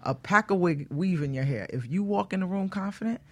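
ordinary talk

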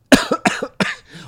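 violent coughing, choking; coughing fit. Real, not acted. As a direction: cough hard, choking on air. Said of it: "Oh, je m'étouffe". (quinte de toux, il s'étouffe)

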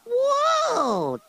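very high, drawn-out whoop of wonder; amazed. Acted. Boy character amazed by how old the story is, exaggerated wonder. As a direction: soar up high in exaggerated awe.

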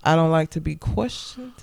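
low, measured voice with long pauses; retold stern warning. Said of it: "breathes hard and says".

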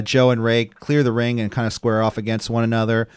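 ordinary talk

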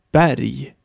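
Berg in Swedish, with a wide open E; very open E before R. Shown as a demonstration. Teacher models Berg for learners, very open E. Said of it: "Noch offener wird das E".

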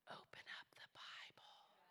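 hushed whisper. Acted. Pastor urging a seeker to read, whispered. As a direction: lean in and whisper it.

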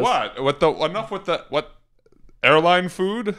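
co-host mocks a standup comic's airline food rant; mock comedian rant. Standup impression mocking.

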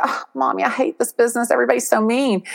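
retold woe-is-me moan; whiny complaint to mom.

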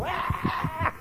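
high mock wail; imitated wail. Preacher imitates mom's tantrum on the ground, mock wail. Imitating mom falling and wailing.

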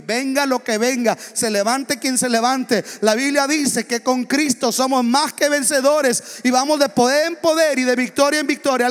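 loud, ringing sermon climax; fiery pulpit proclamation; full-force preaching. Acted. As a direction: rouse the congregation to shout amen.

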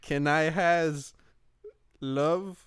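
slow, whimpering mock plea; mock teary plea. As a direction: beg like a sad puppy. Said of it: "la face de". (supplique larmoyante pour rire)

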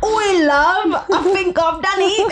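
giggling fake Cockney; overdone British accent; mock British accent. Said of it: "what British people say".

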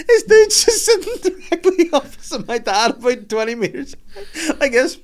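high squeaky talk through laughter; real wheezing laughter breaking up the sentence; helpless laughter. Real, not acted. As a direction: struggle to get the words out, cracking up. Helpless laughter while telling the story.

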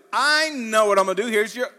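high, loud light-bulb moment; excited preacher's exclamation; excited realization. Acted. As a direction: proclaim it like a sudden discovery. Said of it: "It's sort of the exciting moment".

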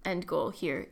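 ordinary talk

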